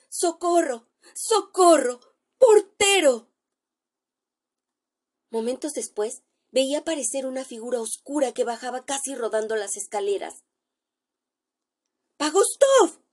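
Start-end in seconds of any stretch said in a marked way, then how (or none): 0.1-3.3 high, loud panicked cries for help; screaming for help. Acted. Man running downstairs screams for the porter, panicked shout. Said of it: "gritando con todas sus fuerzas". 12.2-13.0 high, loud startled call of a name; startled exclamation. Acted. Narrator spots his friend tumbling downstairs, startled cry. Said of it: "exclamé".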